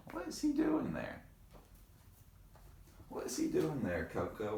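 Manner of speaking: high curious sing-song; high playful question. Acted. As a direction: ask the kids teasingly. Reader asks kids playfully.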